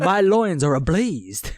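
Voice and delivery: slow, drawn-out Southern drawl; southern accent. Acted. Co-host topping the sweat joke, slow mock Southern accent. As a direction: stretch it like a swooning Southerner. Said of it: "a southern accent going".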